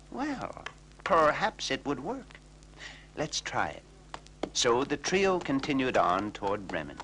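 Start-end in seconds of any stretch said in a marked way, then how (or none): 0.1-3.8 high, musing cat voice; lightly voiced hesitant cat. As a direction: think it over like a shy old cat. Cat reconsidering.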